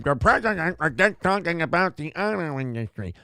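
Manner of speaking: mush-mouthed mocking imitation; funny voice. Host mimics anchor mocking TV pundits, garbled funny voice. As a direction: garble the words in a dopey put-on voice. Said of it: "Doing it in that voice".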